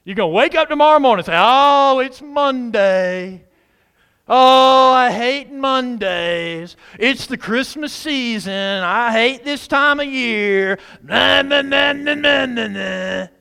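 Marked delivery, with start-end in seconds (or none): 1.4-10.8 loud, slow, drawn-out moaning; exaggerated groan of Monday misery; whiny morning grumble. Preacher mimicking folk waking up on Monday, hammed-up slow whine. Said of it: "feeling just like this". 11.0-13.3 loud, high sing-song whine; hammed-up wordless bellyaching; mock whining. Preacher mimicking grumblers nobody likes to be around, loud mock whine. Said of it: "folk like that".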